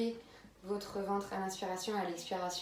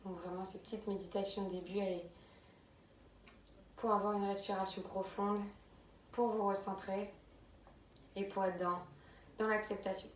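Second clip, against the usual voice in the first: calm, unhurried meditation voice with long pauses; guided meditation; 6 dB quieter than usual. Real, not acted. Yoga teacher easing students into abdominal breathing, calm guided meditation. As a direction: settle them gently into the breath, leaving space between phrases.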